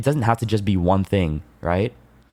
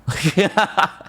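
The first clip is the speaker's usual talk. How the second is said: high laugh; 12 semitones higher than usual. Real, not acted. Laughs at own joke.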